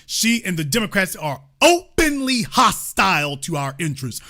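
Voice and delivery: ordinary delivery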